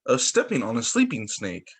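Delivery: sing-song rhyming read-aloud; poem reading. Acted. Reading a rhyme to a child at story time, sing-song.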